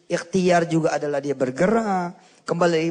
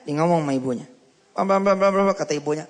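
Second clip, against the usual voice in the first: normal talking voice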